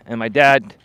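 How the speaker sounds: ordinary talk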